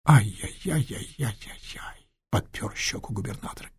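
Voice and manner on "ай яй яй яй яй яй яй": slow whispered ai-yai-yai; whispered lament. Acted. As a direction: sigh out a sorrowful tut-tut. Губернатор сокрушается о смерти. (губернатор шёпотом сокрушается о смерти)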